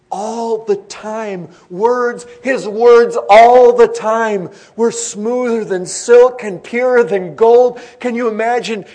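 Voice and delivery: loud, high, drawn-out proclamation; full-force pulpit praise, stretching the key words; exalted preaching. Real, not acted. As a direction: proclaim it with soaring fervor.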